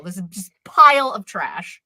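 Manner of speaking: normal talking voice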